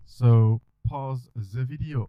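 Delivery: put-on slow, loud French accent. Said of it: "sorry for my French accent just now".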